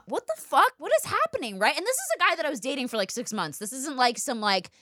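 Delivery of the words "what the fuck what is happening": shocked disbelief. Retold or imitated. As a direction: act out waking to a shock, pitch jumping up. Finding herself hogtied, retold.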